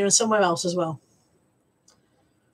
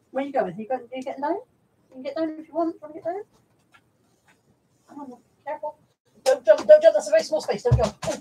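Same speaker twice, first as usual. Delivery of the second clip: high pet voice; 5 semitones higher, faster than usual. Real, not acted. Puppy climbing down.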